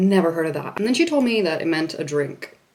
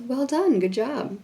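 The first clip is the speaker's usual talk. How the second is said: higher, soft teacher's praise; elementary school teacher; 7 semitones higher, 4 dB quieter, slower than usual. Retold or imitated. Teacher looking at a pupil's drawings, encouraging praise. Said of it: "my teacher looks at my drawings and says".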